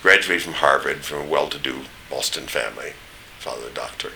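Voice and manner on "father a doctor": normal talking voice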